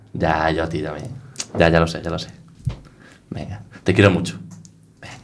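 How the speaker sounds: giggly late night call. Acted. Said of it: "Que te pones muy meloso con tu churri".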